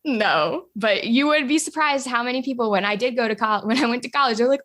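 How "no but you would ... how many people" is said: usual speaking voice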